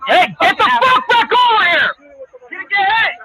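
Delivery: loud, high cracking yell; frantic shout. Real, not acted. Officer screams at fleeing man to come back.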